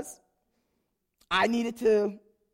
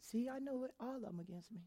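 much softer muttered suspicion; paranoid mutter; 15 dB quieter, faster than usual. Retold or imitated. Her inner voice suspecting everyone, muttered. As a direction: mumble it to yourself darkly. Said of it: "And I'm like".

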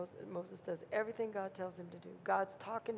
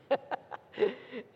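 real laugh; 4 semitones higher, 5 dB louder than usual. Real, not acted. Laughing at own joke.

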